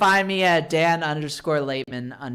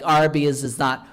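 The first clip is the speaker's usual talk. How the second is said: usual speaking voice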